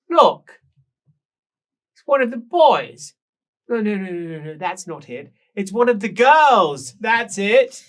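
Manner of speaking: high, loud, slow and theatrical; shrill tipsy woman. Acted. Rouged woman on the stairs gushing at the guests, high and loud. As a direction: gush it like a tipsy lady of the house.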